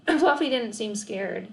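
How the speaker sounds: ordinary delivery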